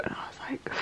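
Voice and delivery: unvoiced whisper aside. Real, not acted. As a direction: whisper it conspiratorially.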